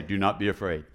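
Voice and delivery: a low, solemn heavenly voice; deep angel voice. Acted. Preacher voicing the angel, deep and solemn. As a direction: drop low for the angel's line.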